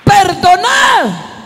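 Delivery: high, slow, drawn-out shouted exhortation; fervent preaching. Acted. Pastor urging the congregation to forgive, slow, high shout. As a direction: stretch each word out and cry it to the church.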